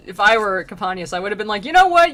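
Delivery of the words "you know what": high, loud retort; mock indignation. Soul arguing back at his scolder, loud mock outrage. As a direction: fire back, playing indignant.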